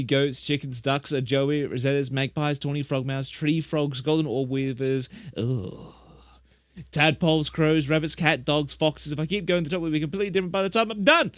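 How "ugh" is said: ordinary talk, nothing marked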